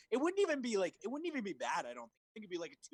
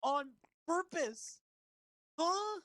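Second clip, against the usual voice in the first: high, stretched disbelief; amazed; 3 dB louder, slower than usual. Real, not acted. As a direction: squeak it out in disbelief. Amazed at the script line.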